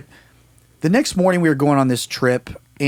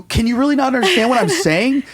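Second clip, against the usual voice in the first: high-pitched, exasperated plea; mock-desperate, voice pushed way up; desperate pleading; 11 semitones higher than usual. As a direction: act out begging to be understood. Acts desperate pleading in the dream.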